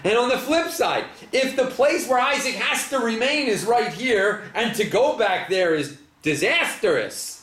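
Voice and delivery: pitched well up, pressing the puzzle; rising disbelief, pushing the contradiction; incredulous questioning. Real, not acted.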